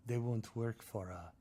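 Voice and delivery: put-on slow, heavy Chechen accent with freak said as frig; slow Chechen gangster accent. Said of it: "I loved his accent".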